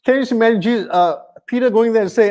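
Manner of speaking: ordinary talk, nothing marked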